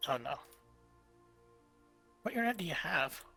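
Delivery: usual speaking voice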